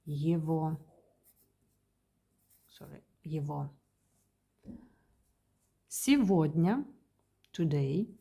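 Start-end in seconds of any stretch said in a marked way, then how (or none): 0.1-0.8 его with a v sound in place of the g; г pronounced as в. Shown as a demonstration. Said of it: "is pronounced as". 3.2-3.7 его with v where g is written; г pronounced as в. Shown as a demonstration. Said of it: "is pronounced as".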